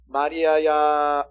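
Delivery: tender call. Acted. Jesus calling Mary at the tomb, slow and tender. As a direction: call Mary's name lovingly and slowly.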